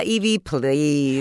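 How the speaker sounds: slow drawn ghostly voice. Pretend. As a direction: stretch it out like a ghost. Ghost voice quote.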